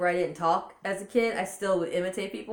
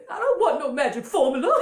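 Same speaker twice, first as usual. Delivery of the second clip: high, loud hammed-up mama voice; Mama Klump; 8 semitones higher, 8 dB louder than usual. Mama in the bedroom refusing potion, high loud voice. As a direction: protest loudly in a high character voice, near laughing. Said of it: "She's like".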